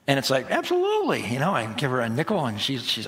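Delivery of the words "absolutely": cheerful yes. Retold or imitated. Dad gladly gives a nickel.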